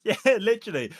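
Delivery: a real laugh spilling into the words; chuckling agreement. Real, not acted. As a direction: laugh through it, bright and high. Laughing at the new car joke.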